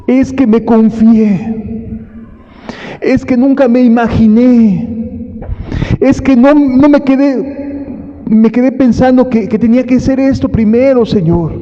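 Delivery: high, desperate excuses to the Lord; pleading foolish virgin. Retold or imitated. Preacher voicing the shut-out virgins begging the Lord, high and pleading. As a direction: beg high and fast as a latecomer shut out. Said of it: "las otras vírgenes diciendo".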